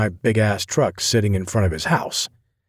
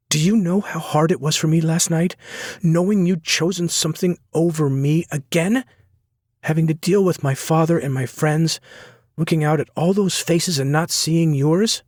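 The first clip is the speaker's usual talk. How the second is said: high, pained accusation; hurt young woman; 8 semitones higher than usual. A narrator's reading. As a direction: let the hurt spill out. Sloane reproaching him.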